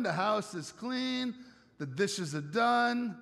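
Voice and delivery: ordinary delivery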